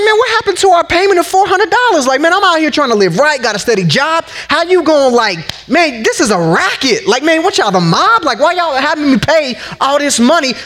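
incredulous, like it's a racket; exasperated rant at a shocking insurance bill; outraged disbelief. Retold or imitated.

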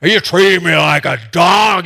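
a modest imitation of a giant taunting; Goliath's deep voice. Giant taunting young David, in a lightly voiced booming challenge. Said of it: "this big old deep voice of Goliath".

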